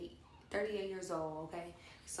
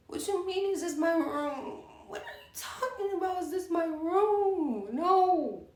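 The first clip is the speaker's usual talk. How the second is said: very high, loud incredulous wail; mock outraged yelling; 11 semitones higher, 11 dB louder, slower than usual. Drunk host answering "this your room?", hammed-up outraged yell. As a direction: yell it in hammed-up disbelief, drawing out the last words.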